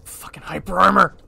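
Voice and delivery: loud curse rising to a high shout; angry gamer yell, climbing in pitch; frustrated yell building. Real, not acted. Raging at enemy's hyper armor.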